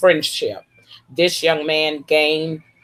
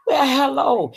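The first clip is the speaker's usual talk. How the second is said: much higher, sing-song welcome; delighted hello; 10 semitones higher than usual. Real, not acted. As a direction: greet a far-away friend with delight. Greeting viewer from Korea.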